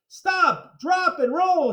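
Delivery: high, urgent shout. Acted. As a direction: yell it in alarm. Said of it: "shouted Mister Klutz".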